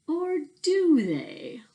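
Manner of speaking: high, slow teasing question; drawn-out coy question; playful tease. Real, not acted. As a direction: dangle the mystery. Teasing question after the text.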